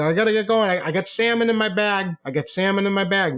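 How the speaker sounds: high-pitched, harried excuse; pleading protest. Retold or imitated. Customer excusing himself with salmon to refrigerate, harried protest.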